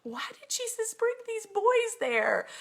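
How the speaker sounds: high, far-off imitation of a worried mom; the preacher mimics the mom asking why Jesus went there; mom's voice. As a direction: squeak it out like an anxious mother. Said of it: "kept saying".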